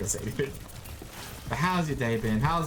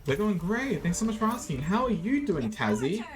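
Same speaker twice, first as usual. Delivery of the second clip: high cheery reply; 6 semitones higher than usual. Pretend.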